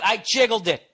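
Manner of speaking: high, loud exasperated retort; retold yelling back. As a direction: yell back in frustration at dad. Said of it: "I yelled at him so much".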